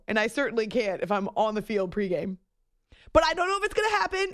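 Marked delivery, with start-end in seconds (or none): 3.1-4.3 fast, high-pitched disclaimer; hurried caveat. Real, not acted. Host hedging on the invite, in a hurried high caveat. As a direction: rush it out high before hopes rise.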